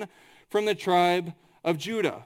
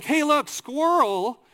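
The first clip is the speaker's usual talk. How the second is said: mock excited call, higher and drawn out; pointing something out; 7 semitones higher, 4 dB louder, slower than usual. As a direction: call attention to a sudden sighting, slowly. Acts out somebody spotting a squirrel.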